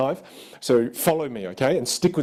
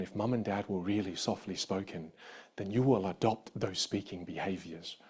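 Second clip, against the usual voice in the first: hushed, lower voice; much softer and lower, as gentle parents would speak; softly spoken; 5 semitones lower, 12 dB quieter than usual. Shown as a demonstration. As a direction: drop right down to a soft, low delivery.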